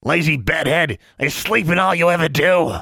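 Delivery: high, scolding creature voice; snapping creature. A narrator's reading. Creature scolds its owner lying in bed, snappish. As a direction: snap it high as a cranky beast. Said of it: "Honey snapped".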